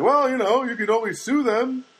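high mocking sarcastic jab; sarcastic quote. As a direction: toss off the sarcastic advice in a high voice. [name] mocking, quoted sarcastic remark.

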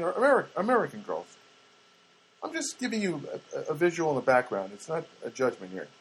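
ordinary talk, nothing marked

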